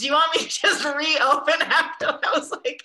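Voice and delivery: high, loud laughing delivery; giggling while teasing a colleague. Real, not acted. Laughing at a beautiful close.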